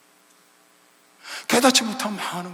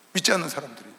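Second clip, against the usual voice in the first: normal talking voice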